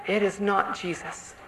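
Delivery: normal talking voice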